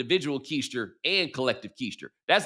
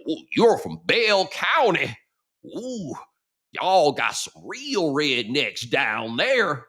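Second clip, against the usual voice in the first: imitated deep rural Southern accent, drawn out slowly; slow, swooping backwoods accent; deep country accent; much slower than usual. Buddy retelling the guy's reaction to Bell County, deep country accent. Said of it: "[name] does a super deep accent".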